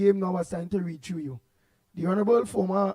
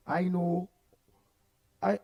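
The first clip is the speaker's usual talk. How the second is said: normal talking voice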